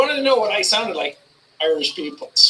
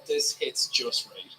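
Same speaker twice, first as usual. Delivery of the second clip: ordinary talk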